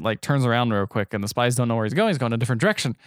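ordinary talk

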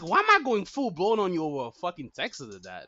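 normal talking voice

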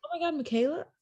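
high, excited gush over a friend's name; bubbly recognition. Retold or imitated. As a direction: retell it with friendly, bubbly surprise. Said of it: "just bubbly energy".

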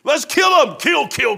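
high, loud mock cry of kill kill; bloodthirsty mock shout. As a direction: ham up an eager mob baying for blood. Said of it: "That's, that's just typically us".

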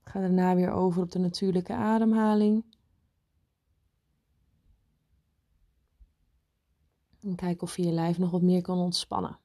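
guided meditation. Acted. Leads a meditation.

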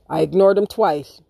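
usual speaking voice

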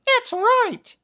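tiny, very high, loud squeak; high-pitched little insect voice; squeaky ant. A narrator's reading. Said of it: "squeaked an ant in the kitchen".

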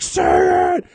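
high, very loud shout; full shout. Retold or imitated. Still pinning the partner in jujitsu, shouting loud. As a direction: scream the order at full force. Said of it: "And I was like".

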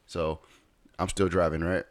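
ordinary delivery

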